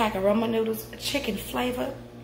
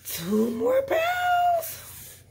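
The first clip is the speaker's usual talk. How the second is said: excited squeal; much slower than usual. Real, not acted. Celebrating weight loss.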